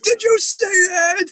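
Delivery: high, loud, slow, stretched question; excited disbelief. Retold or imitated. Investigator to his team after the shadow darts, thrilled. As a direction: point at the shadow in amazement. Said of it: "And I'm like".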